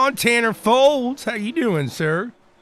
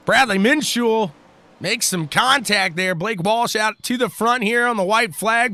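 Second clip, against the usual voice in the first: ordinary talk, nothing marked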